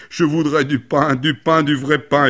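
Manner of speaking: loud, high bawling; hammed-up wailing boy; bellowing wail. A narrator's reading. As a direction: bawl for it like a bellowing animal. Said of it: "le garçon se mit à bramer".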